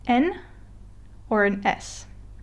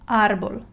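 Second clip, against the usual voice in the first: "árbol" with more force on ÁR; stress on first syllable. Shown as a demonstration. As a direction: read "árbol" properly, punching the first syllable, ÁR-bol. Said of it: "I'll stress the first syllable".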